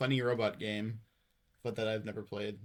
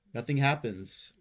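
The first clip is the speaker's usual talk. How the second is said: usual speaking voice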